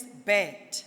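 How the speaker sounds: "bed" slow, with the eh drawn out; vowel sound demo. Shown as a demonstration. Teacher giving an example of eh, "bed" demonstrated slowly. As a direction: stretch the eh in "bed". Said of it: "It says".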